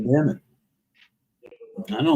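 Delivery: talking through a yawn; words stretched by a yawn. Real, not acted. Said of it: "Well, I keep yawning".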